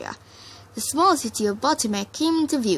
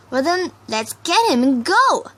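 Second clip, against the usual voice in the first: impatient; 6 semitones higher, 6 dB louder than usual. A narrator's reading. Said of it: "Rainbow said impatiently".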